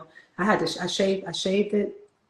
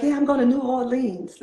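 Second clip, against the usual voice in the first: put-on New York accent; 7 semitones higher, 3 dB louder than usual. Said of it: "And so I was like".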